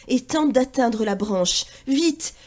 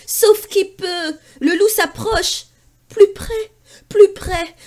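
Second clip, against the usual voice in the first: higher, louder, building suspense; dramatic fright; 7 semitones higher, 6 dB louder, slower than usual. A narrator's reading. Narrator builds terror as the wolf comes near, louder. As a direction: ramp up the danger.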